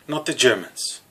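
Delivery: ordinary talk, nothing marked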